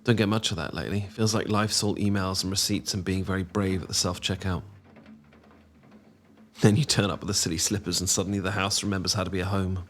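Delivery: ordinary talk, nothing marked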